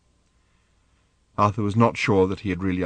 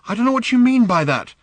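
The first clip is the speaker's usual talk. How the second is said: panting; 13 semitones higher, 6 dB louder than usual. Acted. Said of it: "panting and hurting".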